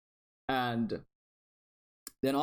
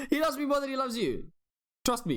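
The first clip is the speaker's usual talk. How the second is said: high-pitched squabbling with some laughter; playful insistence; 12 semitones higher than usual. Real, not acted. Playful squabble over who Tiga prefers.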